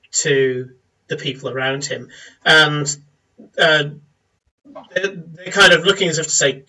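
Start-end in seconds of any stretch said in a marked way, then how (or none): none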